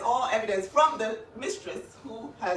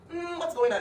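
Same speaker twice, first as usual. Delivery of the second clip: mock bewilderment; 10 semitones higher, 5 dB louder than usual. Mock bewilderment about the affair.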